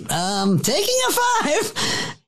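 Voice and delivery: imitating a baffled director stalling for time; awkward director. Director calling a break after the fight, high slow mock voice. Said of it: "And Pete goes".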